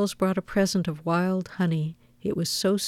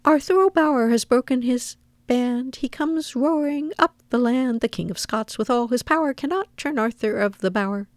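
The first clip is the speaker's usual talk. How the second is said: higher chanted verse, starting loud; impertinent squirrel's riddle; 6 semitones higher, 3 dB louder than usual. A narrator's reading. Nutkin begins again at silent Old Brown, bold high chant. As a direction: declaim the rhyme boldly, pitched up. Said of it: "Nutkin began again".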